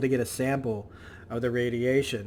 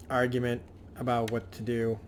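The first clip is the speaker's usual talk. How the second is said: ordinary talk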